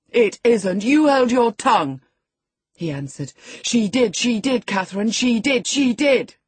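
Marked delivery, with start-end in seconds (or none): 0.1-2.0 put-on loud, high boyish outburst; angry snap. A narrator's reading. Boy answering back at the nurse, loud and cross. 3.6-6.4 high, loud repeated chant; insistent singsong taunt. A narrator's reading. Boy goading his cousin Catherine, chanting loudly.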